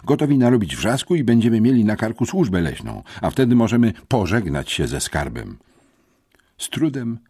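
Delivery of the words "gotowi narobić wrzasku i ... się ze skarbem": agitated scolding, higher and fast; louder, worked-up telling-off; irritated. A narrator's reading. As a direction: rattle off the warning crossly. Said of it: "zdenerwował się Syfon".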